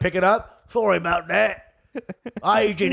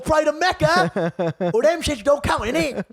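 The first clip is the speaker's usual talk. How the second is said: mock Birmingham accent; 7 semitones higher than usual. Comic voicing a Birmingham-raised guy, high Brummie accent. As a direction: play a Brummie lad. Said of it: "You say".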